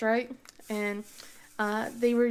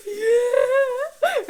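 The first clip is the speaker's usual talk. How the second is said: high, loud mock wail; tearful, wailed imitation; crying; 13 semitones higher, 9 dB louder than usual. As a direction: imitate a child bawling out the answer. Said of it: "she's just crying".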